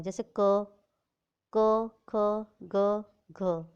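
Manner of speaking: क, ख, ग, घ said loud and clear, each ending in a short अ sound; consonant demo. Shown as a demonstration. Teacher shows children the अ after each consonant, loud and clear. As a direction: call out क ख ग घ with the inherent अ. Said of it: "क के बाद अ का sound आता है".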